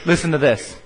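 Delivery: usual speaking voice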